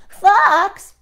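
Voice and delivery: high, loud call; worried gingerbread man. Acted. The gingerbread man calling to the fox, high and loud.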